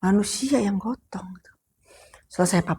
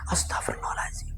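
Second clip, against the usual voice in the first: soft whispered astagfirullah; whispered prayer; 10 dB quieter than usual. Real, not acted. Teller recalling the fear, whispered prayer. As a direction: whisper it in dread.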